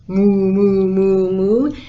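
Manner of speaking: loud imitated moos; booming cow impression; cow mooing. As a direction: moo loudly like a cow. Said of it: "And the cow went".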